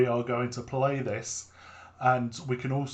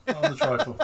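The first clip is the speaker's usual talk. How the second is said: much higher, louder repeat of "Not on the trifle"; shrill-free, high louder protest of "Not on the trifle"; high insistent denial; 12 semitones higher, 4 dB louder than usual. Real, not acted.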